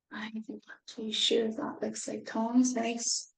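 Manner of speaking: breathy unvoiced whisper. Real, not acted. Reader whispering a doubt to the listening children.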